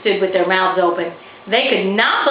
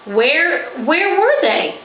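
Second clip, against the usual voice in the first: eager anticipation; 9 semitones higher, slower than usual. A narrator's reading. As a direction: press to know where. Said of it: "Winter asked with anticipation".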